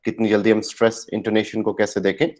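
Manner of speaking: ordinary talk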